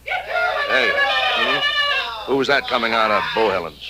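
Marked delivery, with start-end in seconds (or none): 0.0-2.3 very high, far-off cry; distant yell. Acted. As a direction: holler from down the street. Shout heard from street.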